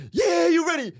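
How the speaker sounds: loud, high pumped-up bro; frat bro. Retold or imitated. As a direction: hype the driver up. Brother hyping him while driving.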